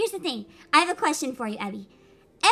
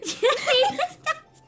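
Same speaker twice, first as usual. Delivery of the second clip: laughing; 7 semitones higher, slower than usual. Real, not acted. Host repeats joke laughing.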